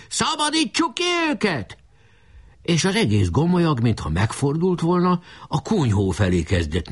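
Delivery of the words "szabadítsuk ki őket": very high rallying cry; yelling. A narrator's reading. Enemy chief urges his troops to rescue, high yell. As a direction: hold the cry at a high pitch. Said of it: "üvöltött Ács Feri".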